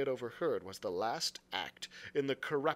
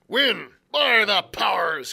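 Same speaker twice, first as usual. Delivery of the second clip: louder, higher pirate roar, slow on the oath; pirate outburst; 5 semitones higher, 13 dB louder, slower than usual. A narrator's reading. Silver scoffing at Israel's win, loud pirate roar. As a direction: bellow the oath at the coxswain.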